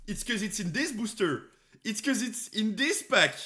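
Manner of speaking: loud and high, half laughing; excited. Real, not acted. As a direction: blurt the theory out. Excited theory shout.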